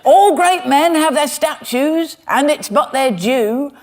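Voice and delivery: a mock cockney music hall performer, milking each line; music hall comic. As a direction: ham it up like an old music hall comedian delivering the gag. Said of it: "Old music hall".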